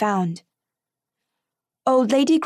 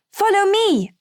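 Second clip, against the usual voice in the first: a loud, higher call to the others; cry out; 10 semitones higher, 4 dB louder than usual. Acted. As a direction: call them after you in a rush. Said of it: "I cried".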